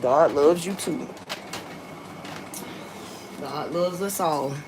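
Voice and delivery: ordinary talk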